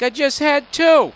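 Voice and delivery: high, loud, drawn-out mock whine; imitated son protesting. Son answers dad about the beers, mock high protest. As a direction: protest loudly as a cornered son, stretching the end. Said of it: "You're just like".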